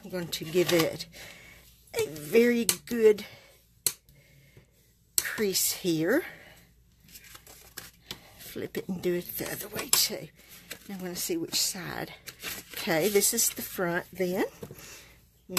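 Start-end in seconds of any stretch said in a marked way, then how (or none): 1.9-9.9 whisper. Real, not acted. Crafter whispers while creasing card.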